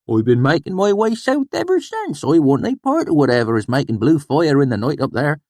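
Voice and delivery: high, loud insistence; testy swineherd. A narrator's reading. Sheem insisting on the date, high and loud. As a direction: correct him sharply. Sheem states his aim.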